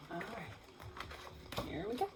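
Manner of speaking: hushed aside; quiet whisper to class. Real, not acted. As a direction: breathe it softly. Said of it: "whispered before reading".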